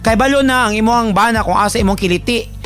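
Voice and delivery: ordinary talk, nothing marked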